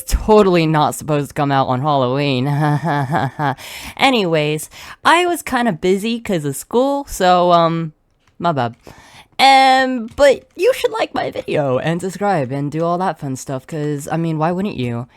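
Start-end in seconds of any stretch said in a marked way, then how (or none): none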